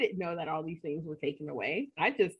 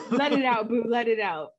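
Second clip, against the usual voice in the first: laughing encouragement; 7 dB louder than usual. Real, not acted.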